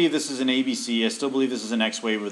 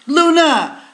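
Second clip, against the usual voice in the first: shouting a name; 11 dB louder than usual. Real, not acted. Calling the pet Luna.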